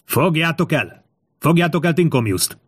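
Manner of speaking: high-pitched narrated yell; shout. A narrator's reading. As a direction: order the capture urgently. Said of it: "üvöltötte Kátó".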